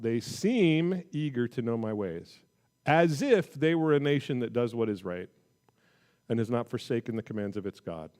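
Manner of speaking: expressive scripture reading. Acted. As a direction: read it like God rebuking his people. Reads scripture with inflection.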